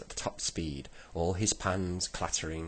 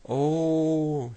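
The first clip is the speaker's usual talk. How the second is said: high, loud, sustained yell; shouted "oh"; 11 semitones higher, 8 dB louder than usual. A narrator's reading. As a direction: yell one long alarmed "oh". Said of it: "shouted Saucepan Man".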